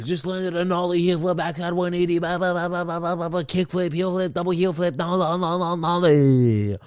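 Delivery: goofy monotone skater character; Doctor Uh. Pretend. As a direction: run the trick names together in a droning babble. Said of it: "I'm Doctor Uh".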